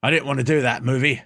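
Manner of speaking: badly faked British accent; horrible British accent. Podcaster mimicking an actor's complaint, bad British accent. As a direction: attempt a British accent and botch it. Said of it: "That was a horrible British accent".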